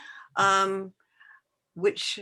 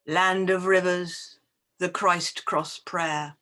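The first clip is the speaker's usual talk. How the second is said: measured, deliberate poem recital with long pauses; poetry reading. Acted. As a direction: read it as verse, lingering on each phrase. Reads own poem aloud.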